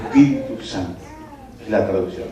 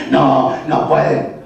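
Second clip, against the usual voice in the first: demon voice; 2 semitones lower, slower than usual. Retold or imitated. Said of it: "habla con una voz de ultratumba".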